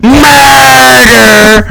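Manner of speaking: high, loud shriek of murder; screaming murder. Retold or imitated. Best friend finds Desdemona dead, shrieking high and loud. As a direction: wail it out like a horrified servant. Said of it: "she's just like".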